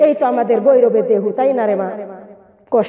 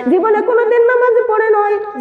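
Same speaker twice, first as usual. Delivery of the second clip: higher pleading call; voiced Prophet; 10 semitones higher than usual. Prophet's voice in sermon. (নবীর কণ্ঠে উঁচু আকুতি)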